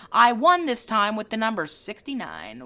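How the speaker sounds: ordinary talk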